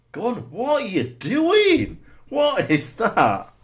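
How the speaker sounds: high, loud and slow outraged yell; real exasperated shout at the goalkeeper; furious disbelief. Real, not acted. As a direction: drag it out in disbelief at the keeper. Goalkeeper blunder, yelling at screen.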